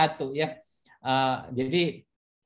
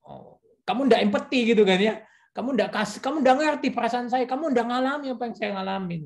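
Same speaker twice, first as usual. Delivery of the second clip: higher accusing complaint; accusing wife quote; 9 semitones higher than usual. Retold or imitated. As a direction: accuse him of not understanding. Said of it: "nanti dia bilang". (istri menuduh, nada tinggi)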